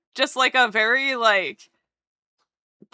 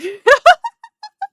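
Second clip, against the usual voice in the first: high burst of laughter; 9 dB louder than usual. Real, not acted. Laughing at own statement.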